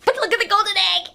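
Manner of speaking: high, fast gleeful mother laughing; delighted laugh. A narrator's reading. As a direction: giggle with delight over the egg. Mother delighted by golden egg.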